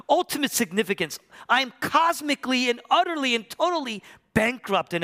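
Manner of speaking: high, loud lament of total loss; impassioned preacher voicing despair; anguished cry. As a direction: cry out as one utterly forsaken. Voices Jesus forsaken on cross.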